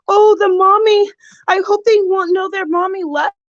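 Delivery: high, whiny imitation of crying; mock tearful mimicry. Streamer mimicking a mother crying on the internet, mock tearful. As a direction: imitate a weepy mommy, pitched well up.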